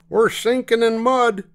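high, loud driver's alarm; urgent calling out. A narrator's reading. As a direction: call a warning to passengers, high and loud. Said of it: "called the driver".

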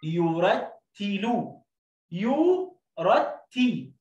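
each word drawn out very slow; teacher stretching يرتي; slow blending. Shown as a demonstration. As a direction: blend syllables slowly. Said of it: "زي كلمة يو لوحدها".

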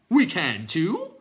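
high, loud, drawn-out clown retort; defiant clown. A narrator's reading. Clown answering the others, loud drawn-out retort. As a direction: stretch it out like a cheeky creature.

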